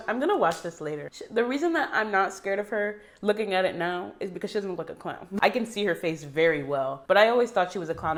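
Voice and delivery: normal talking voice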